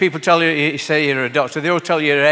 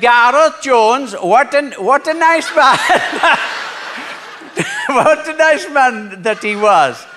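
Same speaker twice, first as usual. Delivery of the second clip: slow, high swoon with giggles; drawn-out dreamy praise, laughing through it; giggling old lady; 9 semitones higher, 5 dB louder, slower than usual. Acted.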